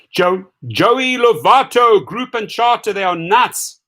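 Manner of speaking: high, slow emphatic call; excited call-out. Real, not acted. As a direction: call him out with fervor. Calling out to a fellow driver.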